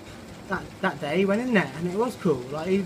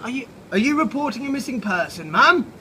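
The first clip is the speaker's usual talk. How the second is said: officious ant officer; 5 semitones higher, 5 dB louder than usual. Acted. Ant officer questions Cynthia.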